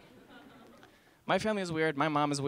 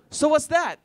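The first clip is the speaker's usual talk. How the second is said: put-on curious kid; 9 semitones higher, 8 dB louder, slower than usual. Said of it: "my little brother's like".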